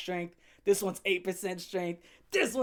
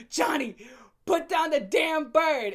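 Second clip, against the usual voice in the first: higher, louder, slow angry command; angry yell; 7 semitones higher, 6 dB louder, slower than usual. Retold or imitated. Girlfriend yelling at Johnny over the bird, loud. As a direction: bark it at him like a fed-up girlfriend.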